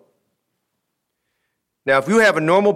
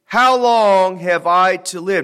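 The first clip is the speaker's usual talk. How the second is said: put-on slow, louder How long have I to live, said as an old man's lament; solemn scripture reading; 6 semitones higher, 6 dB louder, much slower than usual. Shown as a demonstration. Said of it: "analyze that phrase".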